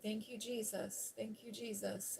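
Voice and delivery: soft, unhurried thanks; really calm. Retold or imitated. Driver thanking Jesus as her passenger panics, softly calm. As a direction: say it softly and evenly, keeping calm. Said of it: "I'm just driving really calm".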